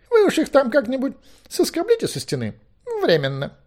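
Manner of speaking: high, loud, fussy figure; high-pitched impatient order that drops at the end; irritated Merlin. A narrator's reading. Merlin tells Owen to scrape languages off, fussily.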